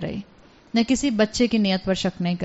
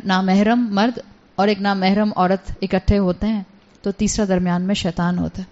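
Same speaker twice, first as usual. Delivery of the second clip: normal talking voice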